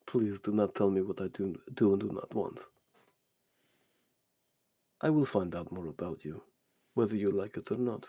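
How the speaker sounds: low, soft, controlled warning; soft-spoken villain putting someone in place; menacing stranger, displeased. Acted. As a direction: quietly assert authority in a low voice.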